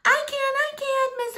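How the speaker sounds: put-on know-it-all girl begging the teacher to call on her; eager teacher's pet. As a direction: bounce in your seat to be picked. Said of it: "says Winifred, the teacher's pet".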